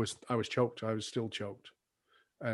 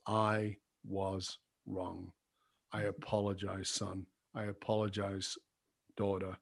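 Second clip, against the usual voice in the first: soft sincere apology; 3 semitones lower, 9 dB quieter than usual. Shown as a demonstration. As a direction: lay it down gently, word by word, to your child. Scripted apology to your children.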